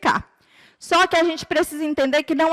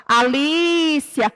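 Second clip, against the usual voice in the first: slow mum's call, stressed vowel stretched long; much slower than usual. Shown as a demonstration. Mum calling pupils by name, calling out. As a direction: call the name across the house, drawn out. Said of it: "Como se fosse a mamãe chamando vocês em casa".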